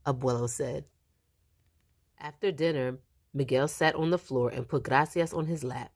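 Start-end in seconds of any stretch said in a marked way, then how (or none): none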